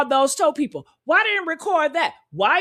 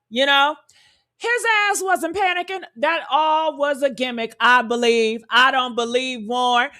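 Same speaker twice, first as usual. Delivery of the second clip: ordinary talk, nothing marked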